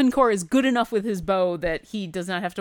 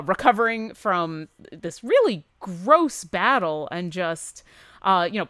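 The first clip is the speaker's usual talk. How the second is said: usual speaking voice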